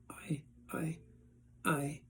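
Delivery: whispered, stammering start; breathless stammer that can't find the words. A narrator's reading. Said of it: "but he couldn't finish".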